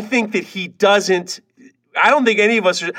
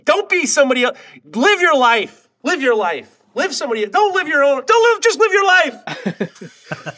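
higher-pitched, worked-up retelling; mock outburst climbing higher as it repeats; retold heated rant; 6 semitones higher than usual. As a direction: imitate someone pleading ever more insistently. Acting out his rant at someone.

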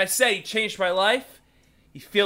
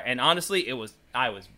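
normal talking voice

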